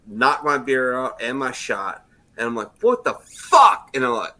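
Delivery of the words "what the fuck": loud, high exclamation of outrage; drawn-out yell of disbelief; retold outburst. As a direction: blurt it out loud and slow, acting the shock. Retold reaction to being hit.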